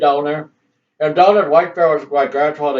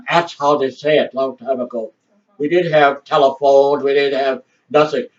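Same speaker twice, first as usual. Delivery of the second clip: normal talking voice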